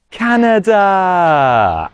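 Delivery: slow grand announcement of Canada. Shown as a demonstration. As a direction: proclaim Canada slowly. Announces country.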